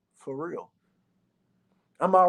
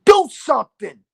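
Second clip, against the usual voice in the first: remembered Dick Gregory voice; 4 semitones higher than usual. Retold or imitated. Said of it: "that motherfucking voice of Dick Gregory said".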